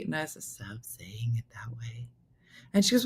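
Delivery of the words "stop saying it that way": mock whispered plea, drawn out; whispered retold objection; hushed retort. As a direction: drop to a slow whisper, imitating a plea. Retold aside to the lady.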